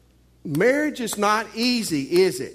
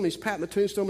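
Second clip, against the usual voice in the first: usual speaking voice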